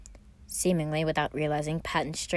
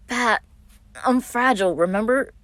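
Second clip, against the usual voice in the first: higher, louder squeak while being hugged; 6 semitones higher, 7 dB louder than usual. A narrator's reading. Virgil crushed in his brother's hug, squeaky protest. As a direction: squeeze the words out mid-hug. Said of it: "Virgil squeaked out".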